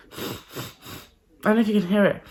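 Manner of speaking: talking through a blocked nose; stuffy nose voice. Real, not acted. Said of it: "it's quite blocked".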